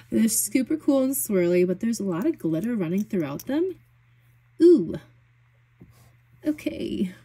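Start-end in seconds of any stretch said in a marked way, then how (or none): 4.6-4.9 high, loud surprised ooh; delighted ooh. Real, not acted. Reacting to a glitter swatch. 6.5-7.1 whispered okay. Real, not acted. Hushed reaction to swatch.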